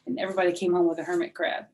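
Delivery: plain reading voice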